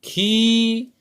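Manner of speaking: खी with a long held ई; modelling a long खी. Shown as a demonstration. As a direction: stretch खी. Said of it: "तो क्या पढ़ेंगे?".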